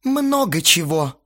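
slow, high, sulky reply; grumpy. A narrator's reading. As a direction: drag it out grudgingly. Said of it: "буркнул Астарот и отвернулся". (буркнуть обиженно, протяжно)

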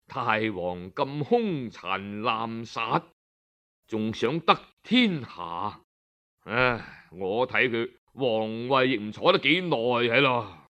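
a head-shaking lament for a cruel ruler; sighing minister. Acted. Minister leaves the court lamenting the king, low and sighing. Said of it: "搖頭嘆息".

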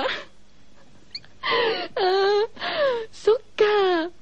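high squeal of a laugh, then an amused sigh; laughing reaction. Real, not acted. Radio host laughing at a listener's postcard. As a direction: crack up at the letter.

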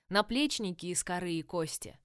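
ordinary delivery

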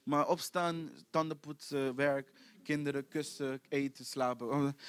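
low, soft, droning list of daily chores; toneless, quiet drone; monotone. Acted. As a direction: recite it like a dreary routine. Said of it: "je leven monotoon laten worden".

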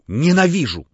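громче, с вызовом; выплёвывает слово; ненависть. A narrator's reading. Лаевский вспыхивает ненавистью. (вспышка ненависти)

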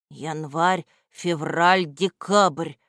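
ordinary delivery

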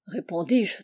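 ordinary delivery, nothing marked

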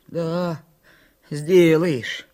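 halting, unsteady grumble; twitching, jerky voice. A narrator's reading. Tearful Митька doubts his friend, twitching voice. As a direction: break it up, voice twitching. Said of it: "сказал Митька дёргающимся голосом".

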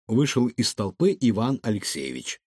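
plain reading voice

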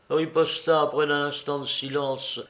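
usual speaking voice

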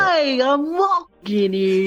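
slow attempt at Southern that stays New York accent; failed Southern accent. Shown as a demonstration. Guest attempting Southern on request, slow New York-sounding accent. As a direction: try a Southern drawl but land on a New York accent. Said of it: "try your best to do a Southern accent".

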